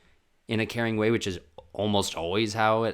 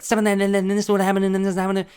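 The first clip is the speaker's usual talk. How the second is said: loud, high, rapid-fire babble; frantic therapy talk; 11 semitones higher, 10 dB louder, faster than usual. Shown as a demonstration. Client to therapist with only an hour, frantic rapid talk. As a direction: race through it at a high, loud pitch. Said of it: "I talk like this".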